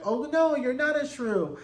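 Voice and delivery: high, drawn-out placating protest; mock reassurance. Imagined husband answers a fake apology, mock reassuring.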